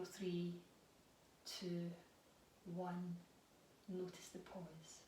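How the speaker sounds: guided breathing. Acted. Leads a guided breathing practice.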